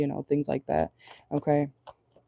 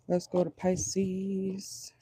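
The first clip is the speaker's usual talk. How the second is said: very slow, softer, stretched-out words; drawn-out; 3 semitones higher, 6 dB quieter, much slower than usual. Real, not acted. Reader moving on to the next sign, slow drawn-out delivery. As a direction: stretch "Pisces" out slowly and softly.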